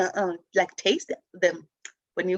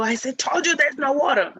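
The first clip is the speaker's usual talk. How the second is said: imitated exasperated parent, raised pitch; frustrated; 6 semitones higher, 4 dB louder than usual. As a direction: snap back in mock frustration. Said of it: "some gets frustrated and go".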